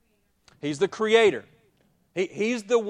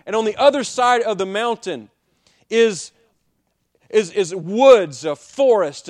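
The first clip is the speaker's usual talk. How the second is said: louder, driving sermon voice; preaching at full force; 4 semitones higher, 8 dB louder than usual. Acted. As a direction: push the picture out to the congregation. Preacher paints the mountain picture.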